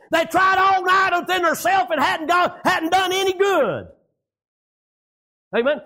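high, driving country sermon delivery; full-force preaching. Real, not acted. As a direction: preach it out hard, drawing out the line ends.

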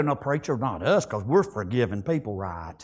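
very low, softened mock protest; protesting churchgoer. Congregation member excusing himself to the preacher, mock protesting in a low voice. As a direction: mimic a churchgoer's objection, dropped right down.

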